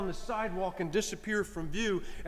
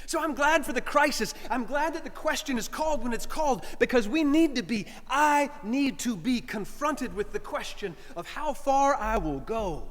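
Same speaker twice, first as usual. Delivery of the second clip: higher, insistent preaching; 5 semitones higher than usual. Acted. Preacher exhorting his congregation, insistent. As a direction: declare it to the flock.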